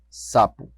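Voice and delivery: sapo said with a lisp; lisped s in sapo, tongue between teeth. Shown as a demonstration. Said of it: "o adolescente de língua presa vai falar".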